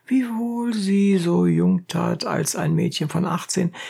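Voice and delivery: usual speaking voice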